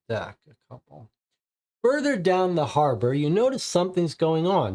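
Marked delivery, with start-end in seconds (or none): 1.8-4.8 card read aloud as a storyteller; story narration. Acted. Game host narrating the visit to the club, storyteller voice. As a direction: narrate the scene like a game master. Reads next location card.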